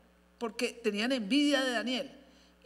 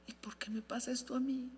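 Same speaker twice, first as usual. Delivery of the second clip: whisper; 2 semitones higher, 8 dB quieter than usual. Retold or imitated. Daniel's inner complaint, whispered.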